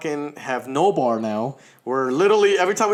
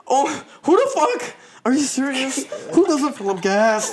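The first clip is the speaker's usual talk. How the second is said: put-on panicking girlfriend; 9 semitones higher than usual. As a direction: imitate her freaking out. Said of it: "She starts panicking hella hard".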